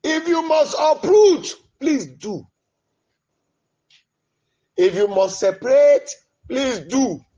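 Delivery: ordinary delivery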